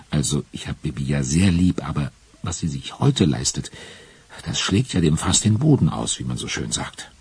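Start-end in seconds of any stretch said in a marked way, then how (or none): none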